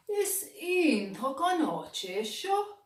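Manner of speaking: a small explorer calling out in wonder; Bingy's voice. A narrator's reading. Bingy asks the other animal, lightly voiced and eager.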